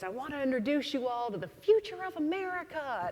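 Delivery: high, booming rodeo announcer; big announcer voice. Retold or imitated. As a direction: play to the whole crowd like a showman. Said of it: "he has this big voice".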